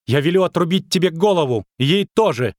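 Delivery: put-on high, imperious child's threat; indignant little princess. A narrator's reading. Little princess threatening witcher and dryad, imperious fury. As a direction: command it in high girlish fury.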